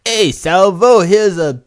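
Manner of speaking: loud, high put-on Aussie call; mock Australian accent. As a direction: holler it in a hammed-up Australian accent. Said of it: "whatever the Australian word is".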